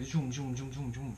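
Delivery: high, quick vehicle zooms; imitated traffic whooshes. Rider showing how Balinese traffic races by, imitated whooshing. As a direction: rattle off zooms like passing scooters. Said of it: "people are, like".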